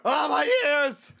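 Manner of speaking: high, loud, drawn-out complaint; slow cartoon wail of pain; pained yell. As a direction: cry out as if deafened. Character hurt by squealing.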